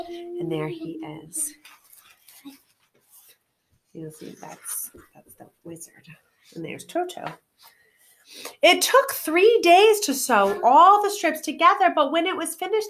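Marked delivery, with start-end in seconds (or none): 0.1-7.3 softly pointing out the picture; hushed aside. Real, not acted. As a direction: drop to a murmur for an aside. Aside showing picture to listener.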